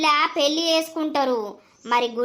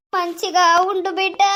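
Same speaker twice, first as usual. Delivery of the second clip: ordinary delivery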